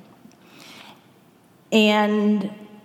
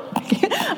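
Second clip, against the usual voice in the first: tearful laugh; 14 semitones higher, 3 dB quieter than usual. Retold or imitated. Laughing through tears at summit.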